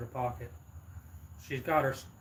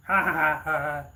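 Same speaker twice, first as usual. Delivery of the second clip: mocking robber laugh; 2 semitones higher, 8 dB louder than usual.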